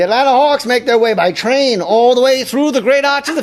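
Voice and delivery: put-on high, fast old-timey announcer; 1940s announcer. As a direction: hype it like an old newsreel. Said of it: "Forties announcer, here we are".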